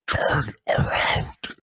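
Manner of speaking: slow, gravelly whispered threat; Arkham Knight voice. Acted. Arkham Knight holding a gun behind Batman, whispered threat. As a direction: menace him from behind, slow. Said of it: "I'm gonna do the whole scene".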